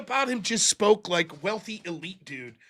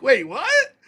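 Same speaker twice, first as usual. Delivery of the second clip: incredulous, rising to a high-pitched question; mock disbelief; 6 semitones higher, slower than usual. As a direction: voice leaping high in imagined bafflement. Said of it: "They'd be like".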